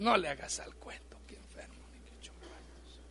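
brushing off his excuse in a whisper; whispered scolding. Retold or imitated. God whispering to the sick pastor, dismissive whisper.

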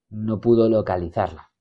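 ordinary delivery, nothing marked

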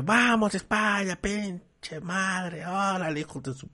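high fan chant fading into a mumble; a hint of a real fan cursing at the stadium; mock rowdy fan. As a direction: start like a rowdy Spain fan, then trail off. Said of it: "no lo que lo digan así".

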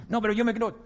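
high, laughing retort; laughing mock reply. A doubter answering back, high laughing voice.

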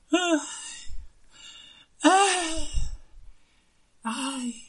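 high, drawn-out lovesick wails. Acted. Lovesick prince wails to the doctor. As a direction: wail like a pining prince.

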